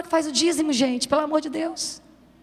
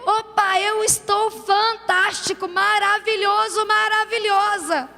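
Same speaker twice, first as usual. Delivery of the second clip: higher, slow, falsely bright boasting; mock cheerful boast; 7 semitones higher, 4 dB louder, slower than usual. Pastor mocking a believer who claims no crisis, falsely bright. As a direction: play the one pretending all is fine. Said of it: "talvez você vai dizer".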